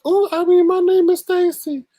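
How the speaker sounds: mock changed voice. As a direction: jump to a very high falsetto. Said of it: "Talking about changing her voices".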